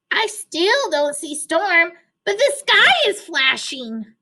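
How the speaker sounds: high duck call from above. A narrator's reading. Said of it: "Duck called down".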